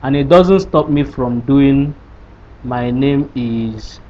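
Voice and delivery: normal talking voice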